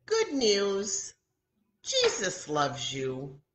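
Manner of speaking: good news read out loudly; proud reading out. Retold or imitated. Teacher reading her craft card aloud, bright and loud. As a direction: announce the words on the heart card. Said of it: "And then I wrote".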